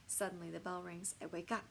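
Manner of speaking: plain reading voice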